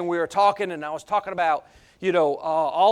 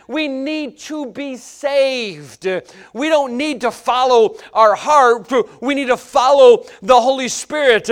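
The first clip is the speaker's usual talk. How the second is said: loud, higher, driving sermon; preaching at full force; 6 semitones higher, 7 dB louder than usual. Acted. Preacher warning the church about emotions, preaching at full force. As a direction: hammer the point to the congregation.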